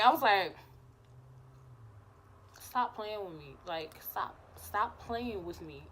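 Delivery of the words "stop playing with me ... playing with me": low, soft, flustered warning; hushed plea. Retold or imitated. Telling flirting crush to stop, hushed flustered plea. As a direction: beg under your breath, slowly.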